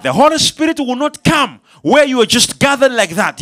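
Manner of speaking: usual speaking voice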